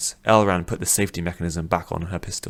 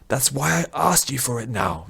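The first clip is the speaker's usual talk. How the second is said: higher, irritated retort; annoyed prisoner snapping at the old man, voice higher; snapped; 6 semitones higher than usual. Acted. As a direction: bite back at him sharply. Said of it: "Vish snapped".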